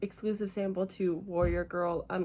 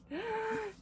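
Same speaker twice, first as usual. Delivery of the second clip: high, soft gasp; delighted gasp; 14 semitones higher, 5 dB quieter than usual. Real, not acted. Seeing her pre-ordered book, delighted gasp. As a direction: gasp in awe.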